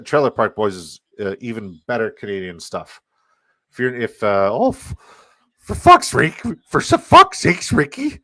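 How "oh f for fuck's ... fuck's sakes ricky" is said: high, loud exasperated squeak; Bubbles impression. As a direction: whine it like Bubbles. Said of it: "Bubbles, man".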